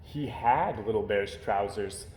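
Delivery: ordinary delivery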